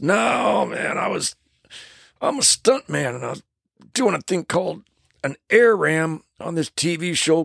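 a hint of a stuntman in pain; injured stuntman. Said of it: "He goes".

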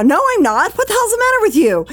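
high, loud, defensive snap; escalating mock-furious retort; indignant yelling. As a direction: yell back in acted outrage. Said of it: "You're yelling right now".

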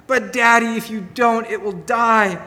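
put-on high, excited kids' voice begging Daddy; pleading children. Children in the back seat begging Daddy to stop the car, high and pleading. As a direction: play a child tugging at Dad to stop.

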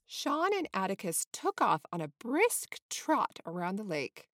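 plain reading voice

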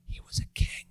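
hushed whisper; whispered aside. Acted. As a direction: drop to a secret whisper. Aside after climax.

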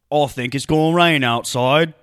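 loud, thick mock British delivery; exaggerated comic British accent; British character voice. Shown as a demonstration. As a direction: put on a dopey British character, rising at the end. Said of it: "Wanna hear a character I've been working on?".

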